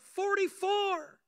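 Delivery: high, loud, drawn-out yell; prisoner shouting out. Acted. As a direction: holler it slowly down the cell block. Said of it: "shouts out".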